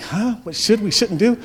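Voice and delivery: high-pitched puzzled echo, without the coach's force; baffled team. Retold or imitated. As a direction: echo the question as a confused player. Baffled players echo coach's question.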